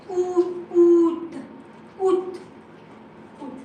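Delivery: "Août" repeated as "oot", one word at a time; silent A. Shown as a demonstration. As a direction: model "Août" without the A, pausing between repeats. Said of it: "We say out".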